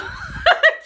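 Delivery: high, loud burst of laughter. Real, not acted. Vlogger laughing at how she looks on camera.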